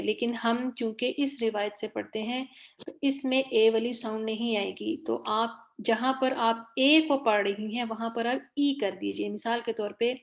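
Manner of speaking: normal talking voice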